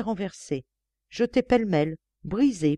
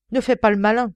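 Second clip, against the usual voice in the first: loud, raised warning voice; stern warning; 5 semitones higher, 7 dB louder than usual. A narrator's reading. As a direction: warn him off, voice raised. Inspector confronts armed killer.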